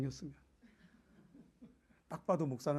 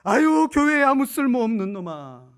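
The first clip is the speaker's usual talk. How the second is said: slow, loud scornful jeering; mock insults; 5 semitones higher, 14 dB louder than usual. Imagined God insulting the congregation, slow loud scorn. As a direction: drag out each insult with contempt. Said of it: "하나님이 이렇게 부르지 않습니다".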